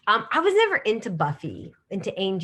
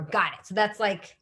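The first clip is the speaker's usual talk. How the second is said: ordinary delivery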